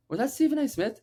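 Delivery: mild mock amazement, pitched high; lightly acted starstruck double-take; retold surprise. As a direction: re-enact a high, astonished question. Retelling his surprise at sighting.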